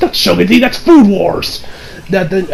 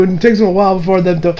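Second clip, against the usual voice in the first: normal talking voice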